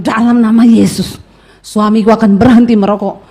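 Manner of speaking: low, firm command in prayer; stern rebuke. Acted. Wife prays against her husband's smoking, acted stern rebuke. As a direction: rebuke with weight on each phrase. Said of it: "dia tengking suaminya".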